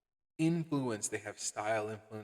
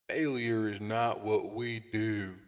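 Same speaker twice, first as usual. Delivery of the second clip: slow, louder hammed-up Southern cowboy drawl; mock Southern drawl; 6 dB louder, slower than usual. Mocking how the comic hero talks, slow Southern drawl. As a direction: drag out the line like a parody cowboy hero. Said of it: "What kind of Southern cowboy Superman talks".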